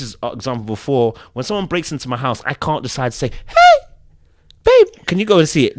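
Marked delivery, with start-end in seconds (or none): 3.5-5.8 high, loud, panicky call to his partner; mock scared plea. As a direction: ham up a frightened man passing the job off. Man imagines asking wife about burglar.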